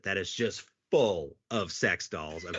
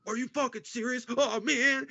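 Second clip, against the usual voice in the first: much higher, half-shouted disbelief; shocked disbelief; 13 semitones higher than usual. Retold or imitated. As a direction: imitate a partner who can't believe it. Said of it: "And he goes".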